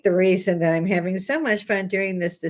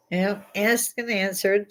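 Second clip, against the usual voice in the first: normal talking voice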